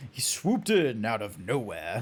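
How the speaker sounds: low impression of a British voice actor; Tony J. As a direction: drop low to mimic the actor. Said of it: "now I know who Tony J is".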